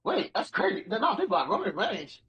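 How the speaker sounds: usual speaking voice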